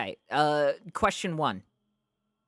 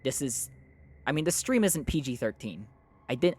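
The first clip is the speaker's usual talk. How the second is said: ordinary delivery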